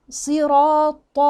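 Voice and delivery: "صراط" chanted slow with dark vowels; all heavy letters. Shown as a demonstration. Teacher models it for learners, slow heavy chant. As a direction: hold "صراط" thick and full throughout. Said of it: "تعتبر دي كلها مفخمة".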